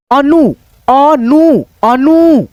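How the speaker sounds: अनु with the final ऊ sound held long; stretched long vowel. Shown as a demonstration. Teacher stretching अनु to show the long vowel. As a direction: pull the end of अनु out slowly. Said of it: "उच्चारण खींच करके है".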